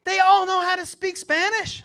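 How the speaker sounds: put-on high, loud kid's claim; boasting son. Son bragging to his dad about Spanish, boastful. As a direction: crow it high and loud like a cocky kid.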